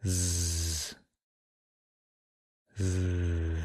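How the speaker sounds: "Z" as a long, low buzz, not the letter name; voiced final sound. Shown as a demonstration. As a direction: hold an isolated buzzing "Z", pause, repeat. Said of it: "Repeat after me".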